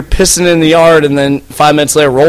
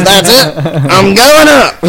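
put-on drunk declaration; 5 semitones higher, slower than usual. Drunk friend announces climbing.

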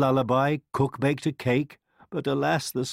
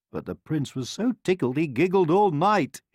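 plain reading voice

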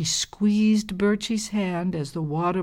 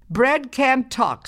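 scornful; 8 semitones higher, 4 dB louder, slower than usual. Acted. Scornful girl.

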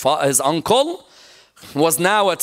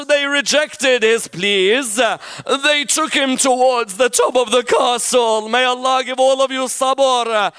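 higher, pleading preacher's lament; higher, impassioned lament for the martyr; impassioned elegy; 8 semitones higher than usual. Acted. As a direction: plead the last wishes to the crowd. Climactic elegy, preacher at full force.

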